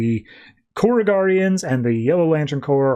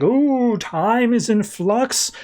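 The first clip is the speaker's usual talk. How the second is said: high, slow, drawn-out oracle voice; eerie prophetic croon; mystic seer; 9 semitones higher, much slower than usual. Acted. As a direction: float the words like a spooky fortune teller. Seer Lyssa giving prophecy.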